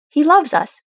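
firm and loud, leaning on loves; staunch. A narrator's reading. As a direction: plant your feet and insist he loves us. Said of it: "Thorin said staunchly".

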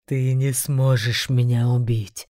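low, slow demonic threat; a beast sneering slowly. A narrator's reading. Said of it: "бросил зверь". (низкий голос демона)

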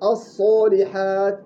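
الصالحات slow, with the ا between alif and waw; correct slow. Shown as a demonstration. Teacher modelling the right vowel, slow. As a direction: say الصالحات slowly with a proper alif. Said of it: "الصالحات صح".

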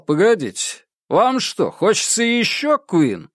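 high-pitched, taunting call to the crowd; goading DJ. A narrator's reading. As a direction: work the crowd up like an emcee. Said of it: "Он вышел на сцену и сказал".

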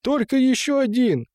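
very high-pitched begging; pleading. A narrator's reading. Watchman begs the men for one chance, pleading. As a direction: squeeze out one last entreaty.

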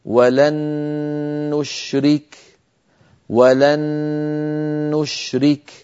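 ولن نشرك بنون مشددة وغنة أكمل ما تكون; أطل الغنة في النون المشددة; غنة كاملة. Shown as a demonstration. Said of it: "هكذا". (نون مشددة بغنة كاملة)

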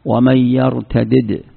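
slow ومن يرتدد with both dals heard; unmerged form. Shown as a demonstration. Teacher quoting the other verse slowly, split dals. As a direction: draw out يرتدد, dal then dal again. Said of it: "وقال في موضع آخر".

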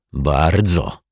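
terse, irritated single word; through gritted teeth. A narrator's reading. Mr Mietek echoes the detective, gritting his teeth. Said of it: "zgrzytnął zębami pan Mietek".

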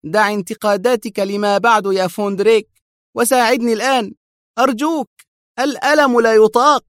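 high, crying plea; tearful pleading cry. A narrator's reading. Henrik begging Vondrik through tears, tearful cry. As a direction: wail and beg in pain. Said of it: "صاح هنريك في صوت باك متضرع".

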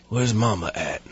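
unapologetic put-on dialect, kept low-key; deadpan cop asking with no shame; lazy, borrowed-dialect cop voice; Steven Seagal impression. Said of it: "goes up to them unapologetically".

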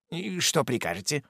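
soft, subdued reply; very soft servile reply; meek servant. A narrator's reading. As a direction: answer the master humbly. Servant answers meekly.

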